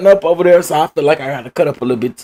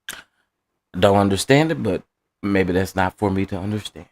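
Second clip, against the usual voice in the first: usual speaking voice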